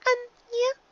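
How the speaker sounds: high filly voice; a sheepish admission; young filly Dinky, sheepish. A narrator's reading. Filly admitting to her mother, high.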